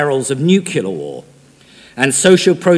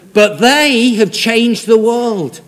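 1 triumphant proclamation; 7 semitones higher, 8 dB louder, much slower than usual. Real, not acted. Preacher's triumphant climax.

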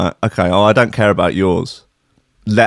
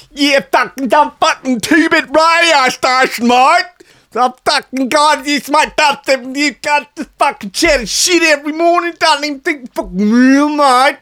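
mock Australian. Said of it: "I've forgotten that Paulie's, um, Australian".

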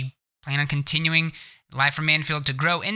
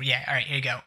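usual speaking voice

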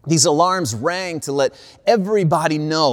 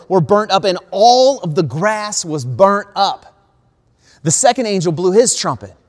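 preaching; 5 dB louder than usual. Acted. Preacher warning his congregation of judgment, forceful preaching. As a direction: proclaim the coming judgment to the congregation.

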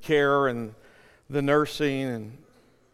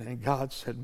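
usual speaking voice

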